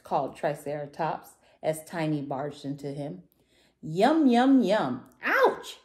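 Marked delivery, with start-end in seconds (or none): 3.8-5.1 greedy munching. A narrator's reading. Dinosaur greedily eating in story. 5.2-5.8 high storybook yelp; hammed-up, very high cry; screamed in pain. A narrator's reading. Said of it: "Tiny screamed".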